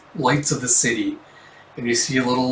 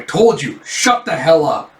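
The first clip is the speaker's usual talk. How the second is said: angry; 3 semitones higher, 4 dB louder than usual. Acted. Officer threatening the suspect.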